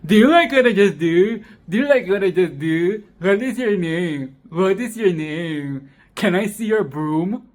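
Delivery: loud, pushy mock of a clingy boy; mocking annoying boy. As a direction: ham up a pestering boy asking over and over. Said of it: "this motherfucker is here like".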